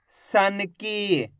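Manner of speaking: सन की very slow, ending on a held ई; joined-up syllables. Shown as a demonstration. Teacher modelling the method for students, slow blended reading. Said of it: "उच्चारण करके इनको पढ़ रहा हूँ".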